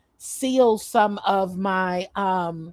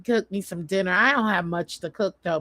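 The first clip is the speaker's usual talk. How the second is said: usual speaking voice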